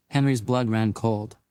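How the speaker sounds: usual speaking voice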